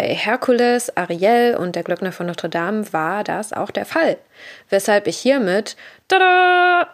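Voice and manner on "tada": high, louder showy tada; mock fanfare. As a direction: present it with a flourish. Comic fanfare.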